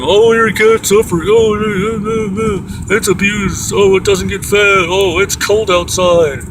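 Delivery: high, slow sarcastic whine; mocking whiny voice. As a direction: mimic them, high and whiny. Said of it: "They decide for 'em".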